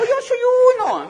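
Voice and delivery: piercingly high, loud holler; imitated crowd yell. People at the back claiming their diamond, high loud shout. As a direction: shriek it like an overexcited follower. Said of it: "muchísima gente que gritaba".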